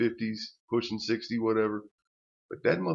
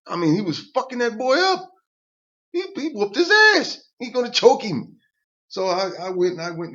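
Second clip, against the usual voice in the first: much higher and louder, excited; telling the audience, getting worked up; worked-up retelling; 10 semitones higher, 6 dB louder than usual. Real, not acted. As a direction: relive the chaos loudly.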